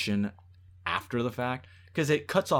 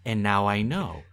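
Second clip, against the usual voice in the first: mock ominous line; 4 semitones lower, 3 dB quieter than usual.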